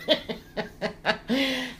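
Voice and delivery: high giggle at the slip. Real, not acted. Host giggling after failing the word again. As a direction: giggle at yourself. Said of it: "I can't say that word".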